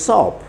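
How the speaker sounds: സോപ്പ് high and falling, one syllable; only O sounded. Shown as a demonstration. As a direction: make സോപ്പ് a single O sound, no A. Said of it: "ഓ മാത്രം ഉച്ചരിക്കാനുള്ള". (സോപ്പ് ഒറ്റ സ്വരത്തിൽ)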